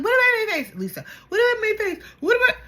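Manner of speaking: high, loud repeated indignant demand; mock outraged shriek. Angie demands Lisa explain the face insult, high indignant shriek. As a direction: shriek it over and over, offended. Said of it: "she's like".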